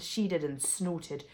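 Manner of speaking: plain reading voice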